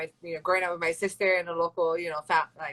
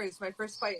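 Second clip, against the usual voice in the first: ordinary talk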